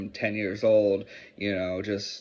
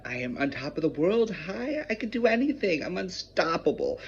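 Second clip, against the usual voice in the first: sudden soaring burst of invincibility, pitched way up; manic euphoria; 8 semitones higher than usual. Acted. Acting out sudden manic high.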